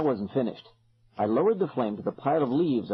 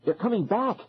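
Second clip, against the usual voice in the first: alarmed cry; 8 semitones higher than usual. A narrator's reading. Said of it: "I cried".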